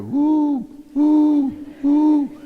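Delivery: high loud imitated woo of night sounds; eerie woo. Boy scout alone overnight, imitating the spooky sounds outside. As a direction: hoot like the spooky woods at night.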